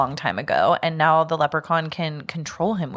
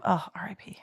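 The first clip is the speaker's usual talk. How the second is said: mock mournful whisper; 7 dB quieter than usual. Real, not acted.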